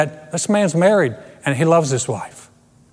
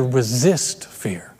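whispered; 5 semitones lower, 4 dB quieter, much slower than usual. Real, not acted. Unvoiced line.

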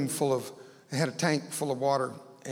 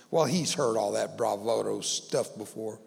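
usual speaking voice

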